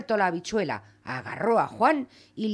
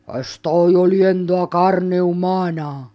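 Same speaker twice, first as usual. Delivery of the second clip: slow, louder menacing giant; terrible giant voice; 8 dB louder, slower than usual. Acted. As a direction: rumble it slowly like a monster sniffing prey. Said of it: "habló una voz terrible".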